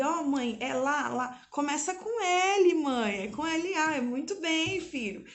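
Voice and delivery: 1 lightly voiced child.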